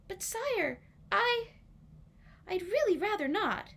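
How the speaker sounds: high, polite plea; timid refusal; high pleading. Acted. Marian begging the king, high polite plea.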